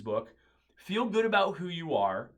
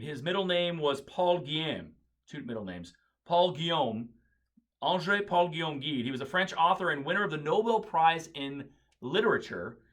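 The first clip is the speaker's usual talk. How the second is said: ordinary talk, nothing marked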